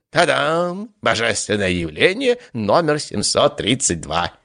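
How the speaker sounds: high, bright fanfare of a boastful god; showy announcement. Acted. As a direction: announce yourself like a showman. God announces his arrival.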